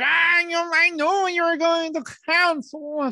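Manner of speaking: a teasing friend, lightly caricatured; imitating Al Wu. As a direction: mimic a friend teasing you. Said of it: "Al Wu was like".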